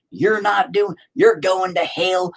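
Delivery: mock a finger-wagging churchgoer; lightly hammed-up scolding believer. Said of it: "they would tell me every damn time".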